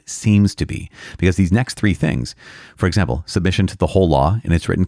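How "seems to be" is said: "seems" carries the weight; stress on seems to be. Shown as a demonstration. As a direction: underline the words "seems to be". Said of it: "I want to emphasize the word".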